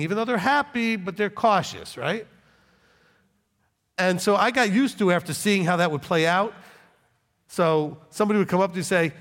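ordinary talk, nothing marked

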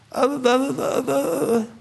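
mocking, high-pitched hemming and hawing; mock stammering excuses. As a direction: imitate someone fumbling for an answer.

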